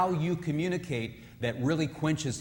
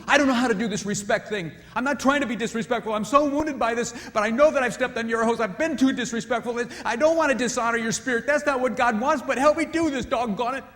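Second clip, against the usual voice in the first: higher, louder, wounded wife asking for help; wife pleading; 9 semitones higher, 9 dB louder than usual. Retold or imitated. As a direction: plead earnestly while hurt. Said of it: "So too".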